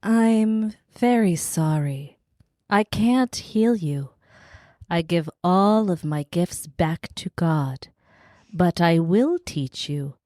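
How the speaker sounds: slow, earnest yogi voice with long pauses; LA yoga instructor. Acted. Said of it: "I'm gonna do my best yogi voice".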